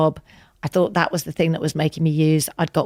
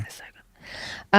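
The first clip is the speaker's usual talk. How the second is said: slow hushed whisper; 6 dB quieter, slower than usual. Acted. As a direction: breathe it out slowly as a secret. Playful aside about Santana.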